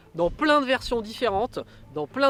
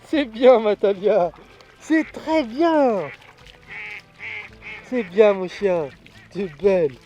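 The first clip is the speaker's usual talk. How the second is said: loud, high, slow praise for the dog; praising a dog; 6 semitones higher, 9 dB louder, slower than usual. Real, not acted. Hunter praises his dog.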